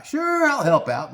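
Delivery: very high, drawn-out mock-willing voice; eager company guy. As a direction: play the overly agreeable employee. Mimics himself as company guy.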